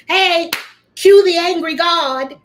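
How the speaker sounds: booming stage cue; loud announcer cue. Acted. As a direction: call out the entrance like an emcee. Announcing angry god cue.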